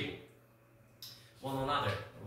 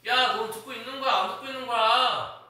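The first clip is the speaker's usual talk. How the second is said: raised, high-pitched teacher's reprimand; scolding; 7 semitones higher, 8 dB louder than usual. Real, not acted. Teacher to inattentive student, loud scolding. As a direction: demand loudly whether they are listening.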